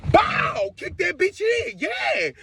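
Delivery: high, drawn-out triumphant yell; hyped mock battle cry; excited re-enactment. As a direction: sound out the kick, then crow. Re-enacts kicking the door in.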